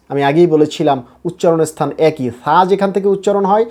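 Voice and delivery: usual speaking voice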